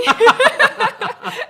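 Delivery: high, loud delighted laugh; burst of laughter. Real, not acted. Laughing at Italy travel perk.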